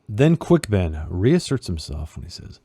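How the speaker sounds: ordinary talk, nothing marked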